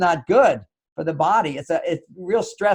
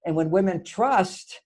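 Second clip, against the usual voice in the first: ordinary talk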